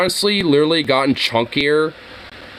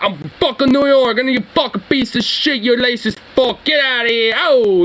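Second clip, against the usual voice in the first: put-on higher, heated New York accent heckle; rowdy New York heckler accent; thick New York accent; 7 semitones higher than usual. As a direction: yell it like a born New Yorker, from the soul. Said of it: "that more thick, like, New York".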